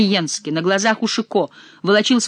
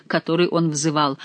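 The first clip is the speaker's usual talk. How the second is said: usual speaking voice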